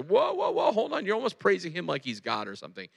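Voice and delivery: high-pitched whoas, then quick protest; alarmed objection. Acted. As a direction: cut in high and fast to halt the praise. Pharisees objecting to the praise.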